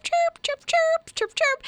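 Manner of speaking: very high, short chirping; squeaky high bird cheeps; imitated bird chirps. Host imitates the bird a lady hears, high chirps.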